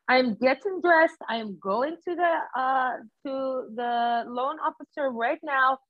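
worked-up client imitated in a Jamaican accent; mock Jamaican-accented declaration. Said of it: "it sounds like a Jamaican accent".

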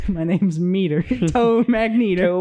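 Tow Mater. Acted. Mimicking Tow Mater on hearing Magneto.

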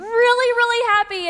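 "really happy" drawn out, high and loud; emphatic. Real, not acted. Speaker stressing to the audience how happy she can be, emphatic.

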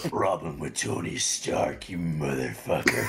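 low growled death metal rant. Shown as a demonstration. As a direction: snarl it like a metal frontman. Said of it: "channel so much death metal".

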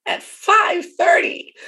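high, slow, loud five thirty; stressed time. Retold or imitated. Daughter complaining about mom's early airport time, slow and high. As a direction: drag out five thirty in disbelief.

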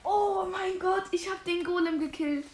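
excited cheer. Real, not acted. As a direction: savor the victory loudly. Golem killed celebration.

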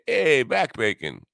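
drawn-out New York greeting; New York hey. Retold or imitated. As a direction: call it out like a New Yorker, hey first. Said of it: "In New York, we say".

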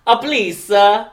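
high, loud fake Italian accent; terrible Italian accent. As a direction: plead grandly in bad stage Italian. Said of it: "Terrible Italian accent".

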